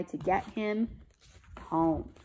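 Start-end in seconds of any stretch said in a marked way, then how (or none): none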